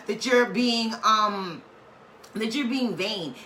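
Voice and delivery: ordinary delivery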